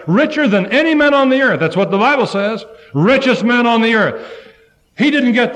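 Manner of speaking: high, loud preaching burst; preaching emphasis. Acted. Preacher extolling Job's wealth, in a high, loud burst. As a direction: proclaim his riches.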